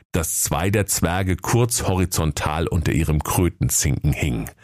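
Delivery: ordinary delivery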